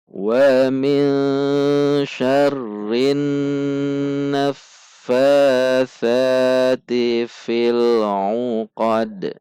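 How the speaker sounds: slow chanted tajwid recitation with long held vowels; measured Quranic chant. Shown as a demonstration. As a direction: recite it slowly, stretching the long sounds. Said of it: "Kita baca sekali lagi".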